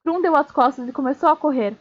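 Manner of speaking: plain reading voice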